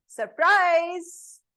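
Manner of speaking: imitating a long drawn-out exclaim; dragged surprise. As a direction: drag the word out to grab attention. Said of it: "This dragged surprise part".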